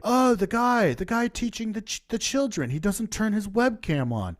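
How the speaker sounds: high, sneering parody of the film's dialogue; mocking voice. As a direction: mimic it like a pompous narrator pointing out the obvious. Mocking the film's obvious writing.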